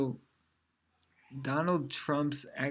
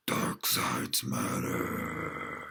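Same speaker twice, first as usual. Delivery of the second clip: very deep, drawn-out villain voice; slow, booming-low movie villain; Darth Vader; 3 dB quieter, much slower than usual. Acted. Darth Vader declaring his slogan, deep and slow. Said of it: "Darth Vader is really into".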